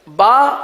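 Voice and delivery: "با" said loud and clear; model letter. Shown as a demonstration. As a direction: announce the letter "با". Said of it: "یہ ہونٹوں سے ادا ہوتا ہے".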